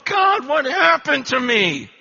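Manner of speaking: loud, high, slow cry to God; a slow loud plea heavenward; anguished cry. Retold or imitated. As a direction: cry out in dismay at yourself. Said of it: "I'm just going".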